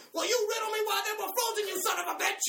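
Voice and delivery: high, loud fake fury; mock rant. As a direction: yell at the manager in fake rage. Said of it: "started going crazy on him".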